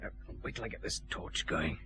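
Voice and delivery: very soft, low whisper; hushed whispering. Acted. Detective lighting a torch inside the dark warehouse, whispering. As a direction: whisper it under your breath. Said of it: "all whispering for".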